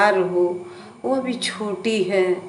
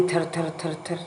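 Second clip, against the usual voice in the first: trembling with fear; 2 semitones lower, 9 dB quieter, faster than usual. A narrator's reading. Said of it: "कांपने लगी".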